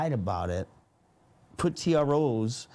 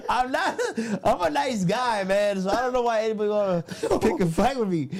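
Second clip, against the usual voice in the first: higher, louder, talking through laughter; laughing protest; 9 semitones higher, 4 dB louder than usual. Real, not acted. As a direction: protest your innocence while laughing. Laughing, claims to be nice.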